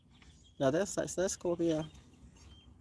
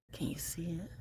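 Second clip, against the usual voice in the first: whisper; 4 semitones higher, 11 dB quieter than usual. Real, not acted. Whispered question about the bird.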